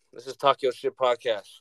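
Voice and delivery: ordinary talk, nothing marked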